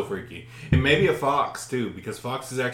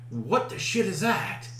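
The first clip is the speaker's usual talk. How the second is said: slow, higher disbelief; baffled astonishment; 5 semitones higher, 3 dB quieter, slower than usual. Retold or imitated. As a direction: stretch it out in re-enacted bewilderment. Acts surprise at seeing the animal.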